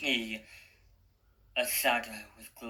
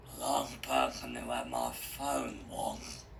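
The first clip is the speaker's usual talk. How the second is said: lower, thoughtful clown voice; lightly voiced villain; 12 semitones lower, 3 dB quieter than usual. A narrator's reading. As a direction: muse as the ringleader, lower. Harry's voice.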